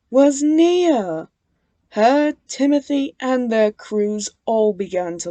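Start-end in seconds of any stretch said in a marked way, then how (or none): none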